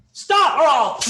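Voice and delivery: loud high barked order to stop; barking police commands. Acted. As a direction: yell it like a cop giving chase. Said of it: "Detroit cop, here we go".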